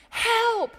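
high, loud, long cry; one last big acted call for rescue; mock screaming. Man almost giving up on the cliff, loud high cry. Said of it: "he starts screaming".

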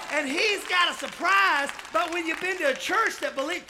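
loud, high, driving sermon delivery; impassioned preacher at full cry; full-force preaching. Real, not acted. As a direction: drive each phrase home to the congregation.

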